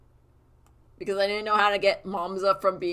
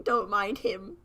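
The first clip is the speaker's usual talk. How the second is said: ordinary delivery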